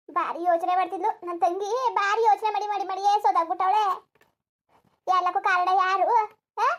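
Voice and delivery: loud, bitter lament and scolding; loud grieving outburst. Acted. Grieving aunt scolds the maid and mourns her sister, loud. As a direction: shout your grief at everyone.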